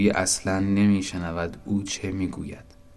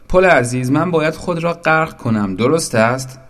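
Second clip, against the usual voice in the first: raised, high-pitched eager cry; excited shout; 7 semitones higher, 6 dB louder than usual. A narrator's reading. Boat asking bridge if it must drown, excited shout. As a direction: call up urgently for confirmation. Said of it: "زورق فریاد زد".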